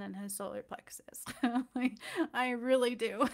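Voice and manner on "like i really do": laughing. Real, not acted. Laughing about giving her DM love.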